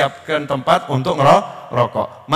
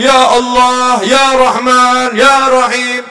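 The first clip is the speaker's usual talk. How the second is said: loud, higher, slow fervent calling; bold prayer; 4 semitones higher, 5 dB louder, slower than usual. Shown as a demonstration. Preacher showing the crowd a bold prayer, loud. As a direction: call the names with full force. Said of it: "Jangan disuruh baca Asmaul Husna".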